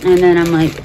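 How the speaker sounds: usual speaking voice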